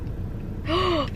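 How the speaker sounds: gasp. Real, not acted. Disgusting beauty blender shown.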